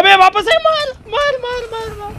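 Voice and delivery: high, frantic shout to shoot; frantic kill shout. Real, not acted. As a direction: scream at the squad to fire. Enemy back, frantic kill call. (बेहद उत्तेजित चीख)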